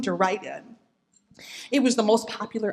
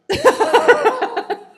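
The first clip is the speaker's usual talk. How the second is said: high bursting laugh; real laugh; 10 dB louder than usual. Real, not acted. Teacher laughing at Mom's answer, real laugh. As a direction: laugh out loud at Mom.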